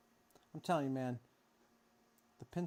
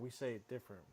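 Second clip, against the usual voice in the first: ordinary talk, nothing marked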